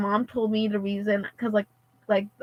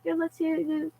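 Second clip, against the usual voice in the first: imitating her, high and soft; soft, high-pitched voice of a kindly helper; nice helper; 7 semitones higher, 7 dB quieter than usual. Kindly bathroom monitor offering to check the phone, nice and soft. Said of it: "she was really nice".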